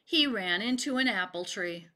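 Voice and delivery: ordinary delivery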